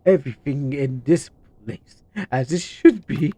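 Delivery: slow, lofty menacing villain; a cult leader preaching calmly. Acted. Voicing villain dialogue.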